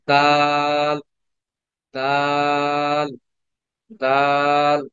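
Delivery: দাল drawn out slowly, the vowel held long; long drawn dal. Shown as a demonstration. Teacher to online class, reading দাল long and slow. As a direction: stretch দাল out long, chant-like. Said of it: "লম্বা করে পড়তে হবে".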